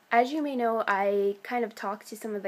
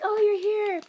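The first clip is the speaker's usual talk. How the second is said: high, lifted burst of recognition; excited recognition; 11 semitones higher, 4 dB louder than usual. Retold or imitated. Actor finally recognising the fan at stage door, excited. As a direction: imitate a thrilled hello, pitched way up. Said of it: "he got really, like, excited".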